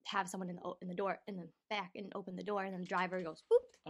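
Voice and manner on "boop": quick high boop; sudden squeaky "boop"; imitated grab noise. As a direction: pop out a loud, high sound effect. Imitates driver grabbing someone.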